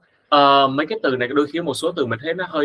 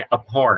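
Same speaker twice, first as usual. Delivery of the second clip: "abhor" as ab-HOR; second-syllable stress. Shown as a demonstration. Teacher drills the stress, ab-HOR. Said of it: "nhấn âm số hai".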